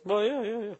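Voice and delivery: imitating a sound guy, hammed-up; mock sound guy. Mimicking the sound guy's answer.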